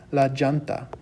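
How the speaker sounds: "llanta" said as "JAHN-tah"; hard j opening "llanta"; J-sound double L. Shown as a demonstration. Teacher showing how many others say tire, "llanta" with J. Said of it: "sort of like a J sound".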